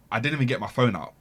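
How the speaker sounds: ordinary talk, nothing marked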